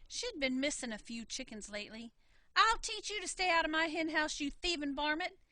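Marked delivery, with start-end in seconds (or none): 2.6-5.4 louder, higher scolding; angry threat. Acted. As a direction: chase off the thief with a skillet.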